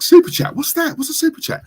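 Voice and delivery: very high squeaky mimicry; exaggerated high-pitched puzzlement; mock bewilderment. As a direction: act clueless in a tiny high voice. Mimics own bewilderment at super chats.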